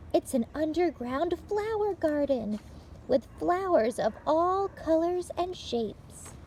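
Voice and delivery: bright, lively read-aloud for little listeners; picture-book reading. Acted. Librarian reading a picture book to children, lively read-aloud. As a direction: tell it like story time for small children.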